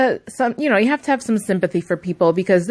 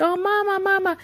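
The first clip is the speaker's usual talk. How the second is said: put-on high, soft whiny child voice; whining toddler; 12 semitones higher, 4 dB quieter than usual. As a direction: imitate a small boy whimpering for his mom. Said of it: "he starts kind of whining again".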